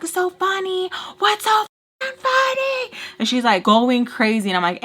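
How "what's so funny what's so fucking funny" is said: much higher mock-angry voice, climbing even higher; mock mad outburst. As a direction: imitate her rising, high-pitched indignation. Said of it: "She is mad".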